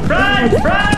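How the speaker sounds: high panicked shout; screaming at a spider. Real, not acted. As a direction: yell it in a panic. Spider chases the group.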